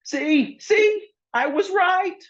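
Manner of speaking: very high, slow, sing-song I-told-you-so; triumphant gloat. Acted. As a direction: crow over being right. Lorelei triumphant over confession.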